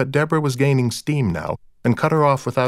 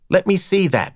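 sharp, higher demand for the paper; 9 semitones higher than usual. A narrator's reading. Recht to Deborah, demanding the paper, pitched up. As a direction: snap it out, insisting. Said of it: "Recht demanded".

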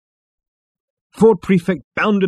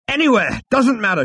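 high, hurried shouting; impatient shout; 12 semitones higher, 4 dB louder than usual. A narrator's reading. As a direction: shout it off dismissively. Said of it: "shouted Zaphod".

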